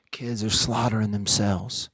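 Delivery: ordinary talk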